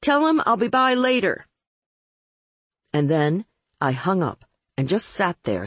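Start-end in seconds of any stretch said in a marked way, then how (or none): 0.0-1.5 high-pitched yelled message; yelling. A narrator's reading. Caller leaving word over the vamp's effusions, yelling. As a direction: yell the message down the line. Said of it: "I finally yelled".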